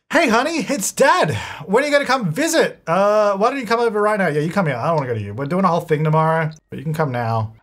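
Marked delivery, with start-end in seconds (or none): 0.1-4.3 higher, hammed-up fatherly phone voice; dad calling his kid over, a lightly put-on fatherly voice.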